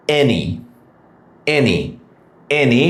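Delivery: "any" starting with "e", as "en-ee"; E sound. Shown as a demonstration. Teacher showing the vowel, "any" as "en-ee". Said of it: "এখানে উচ্চারণ হচ্ছে এ".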